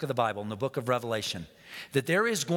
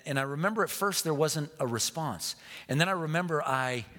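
usual speaking voice

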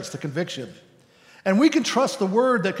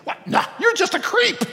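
far higher, louder mocking jeer; condemning voice; 3 dB louder than usual. The voice of condemnation insulting the believer, high mocking jeer. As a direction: jeer at him like a bully. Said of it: "when it says".

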